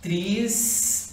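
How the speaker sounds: "Tristeza" as three slow, separate syllables; split into syllables. Shown as a demonstration. Teacher separating the word for the class, split into syllables. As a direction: break "Tristeza" into tris, te, za with pauses. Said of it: "Vamos separar a palavra tristeza".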